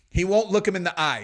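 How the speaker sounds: ordinary delivery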